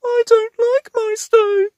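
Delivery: Lofty quivers that he dislikes mice; quivering. A narrator's reading. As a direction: quiver with fear. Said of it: "quivered Lofty".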